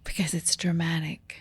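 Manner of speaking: hushed theatrical whisper; dramatic whisper. Pretend. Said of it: "For dramatic effect".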